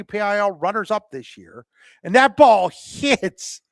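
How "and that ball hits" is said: loud call breaking into a chuckle; real amusement bubbling through the commentary; amused laughing. Real, not acted. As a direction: call it while trying not to laugh. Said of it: "I'm kinda laughing".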